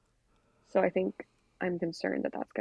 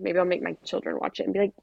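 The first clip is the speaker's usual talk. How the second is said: ordinary delivery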